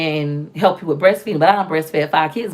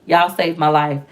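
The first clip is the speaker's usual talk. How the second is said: ordinary talk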